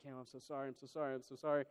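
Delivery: hushed, repeated pleading apology; acted-out quiet contrition; retold soft apology. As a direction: apologize gently to a hurt child. Dad retells soothing his hurt son.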